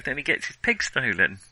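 usual speaking voice

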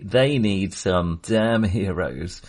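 ordinary talk, nothing marked

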